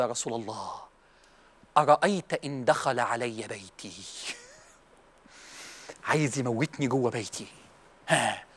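very low, soft, slow plea; hushed question. Acted. Companion anxiously questions the Prophet.

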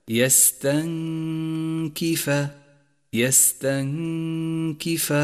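"يستنكف" chanted, n hidden in a long nasal hum before k. Shown as a demonstration. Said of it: "أي هكذا". («يستنكف» بإخفاء النون مع الغنة)

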